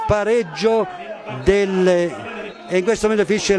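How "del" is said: normal talking voice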